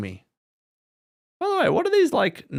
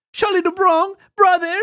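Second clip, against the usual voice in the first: much higher mock Brazilian "brother"; Charles Oliveira impression; 14 semitones higher, 3 dB louder than usual. Podcaster imitating Bedtime's Oliveira impression. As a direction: pitch it up like Oliveira greeting a rival. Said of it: "He does a really good Charles Oliveira".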